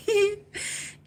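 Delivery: high giggling burst; high giggle. Real, not acted. Giggling before teasing Johnny with a song.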